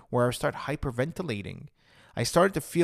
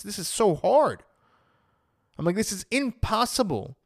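raised pitch, exasperated protest; frustrated disbelief; 7 semitones higher, 3 dB louder than usual. Retold or imitated. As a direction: retell an incredulous, frustrated objection. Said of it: "I was so frustrated by that answer".

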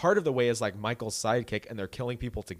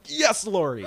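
cheering; 6 semitones higher, 5 dB louder, slower than usual. Retold or imitated. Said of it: "And I was like".

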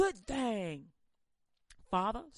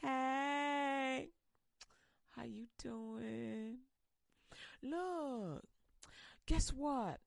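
Eve cooing at her husband; flirty Eve; much slower than usual. Acted. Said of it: "Eve looking at him going".

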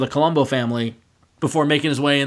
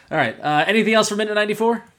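ordinary talk, nothing marked

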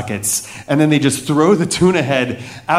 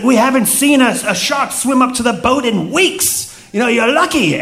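hammed-up higher pirate accent, half laughing; 9 semitones higher than usual. Captain telling the tourist he's lucky, in a hammed-up pirate accent. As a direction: play the boat captain as a cartoon pirate. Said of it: "so you're gonna get pirate".

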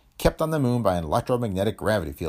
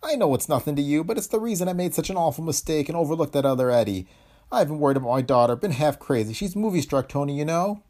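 high, troubled voice of a worried father; distraught father; 7 semitones higher than usual. A narrator's reading. Father excusing his mistake to Tony, distraught. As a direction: explain yourself in a high, upset voice.

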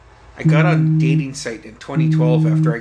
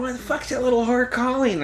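over-the-top pissed-off mom; 10 semitones higher than usual. Retold or imitated. Mom reacting after hanging up the phone, over-the-top pissed off. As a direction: imitate an angry mom in a high voice. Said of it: "fucking over the top, pissed off".

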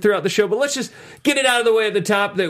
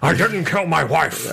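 slow, lightly voiced Harrison Ford; Harrison Ford impression. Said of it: "That's my, uh, Harrison Ford".

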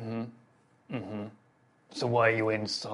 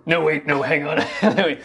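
normal talking voice

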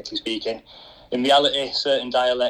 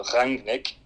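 "Rangnick" stressed on RANG; stress on first syllable. Shown as a demonstration. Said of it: "emphasis on the first part".

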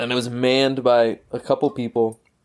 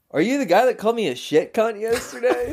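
indignant stranger; 9 semitones higher than usual. Retold or imitated. Stranger confronts him next day.

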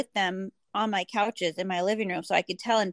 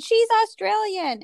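quoting friend; 12 semitones higher, 5 dB louder than usual. Retold or imitated. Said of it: "And she said".

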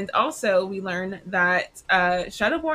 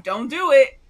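urgent plea; 4 semitones higher, 10 dB louder than usual. Retold or imitated. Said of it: "Nick is like".

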